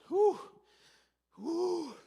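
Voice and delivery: big, high exaggerated sigh of relief; loud relieved whew. Worker relieved the boss left, loud sighing whew. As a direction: blow out a huge whew.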